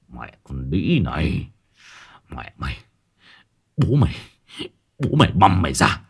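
furious hiss through the teeth; hissing with rage. A narrator's reading. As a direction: spit the curses, seething. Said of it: "Báu rít lên". (rít lên giận dữ)

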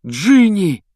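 loud, high exclamation; overjoyed cry. Acted. Brother seeing his rescued sister, crying out joyfully. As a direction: cry out her name in relief.

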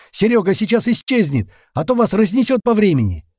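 high, loud, pressing warning; urgent shout. A narrator's reading. Captain warns Zhenka time is running out, urgent shout. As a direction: shout the warning against the clock.

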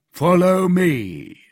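slow, high, loud summons; commanding officer. A narrator's reading. Officer summoning the prisoner from his cell, commanding. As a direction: call the prisoner out slowly.

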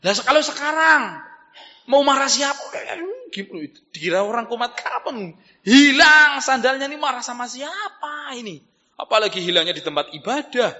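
high-pitched, animated preacher's voice; insistent rhetorical preaching; heated preaching. Acted. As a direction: argue the point with rising heat. Preaches with raised voice.

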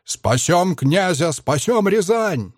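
slow, high drawn-out chant; rallying cry. A narrator's reading. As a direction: stretch it out like a war chant.

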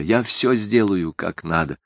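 ordinary delivery, nothing marked

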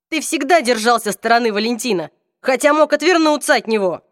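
angry accusation. Acted. Accusing Hodge.

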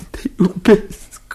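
anguished love cry. Acted. Jasper crying his love to Rose. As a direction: cry it out slowly, almost wailing.

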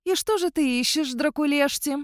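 high, honeyed sweet question; unctuous. A narrator's reading. Heroine steps out to question werewolf, oily sweetness. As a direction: coax sweetly, pitched well up. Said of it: "елейно спросила я".

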